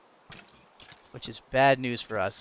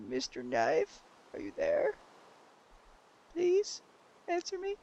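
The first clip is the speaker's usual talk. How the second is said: timid, rising plea to an object; mock pleading. Gamer calls for his lost knife, mock pleading.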